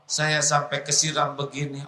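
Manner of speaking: normal talking voice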